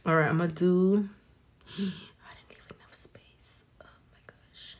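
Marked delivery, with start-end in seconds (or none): 1.4-4.8 breathless whisper after a gasp; whispered dismay. Real, not acted. Realizing she left no space on the nail, whispering. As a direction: whisper it, caught out by the mistake.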